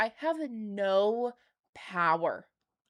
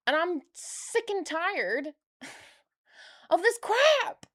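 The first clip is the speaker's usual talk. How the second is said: boil over with frustration; angry outburst; 7 semitones higher, slower than usual. Real, not acted. Ranting about corporate impunity.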